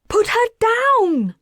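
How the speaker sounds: very high, loud panicked yell; a mother shouting to save her child. Acted. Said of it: "yelled the mother".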